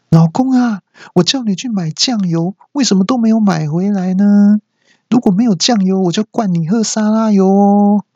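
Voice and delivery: high sing-song wife nagging her husband. Acted. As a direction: whine the nag like a sweetly menacing wife. (妻子尖声撒娇式地碎念老公)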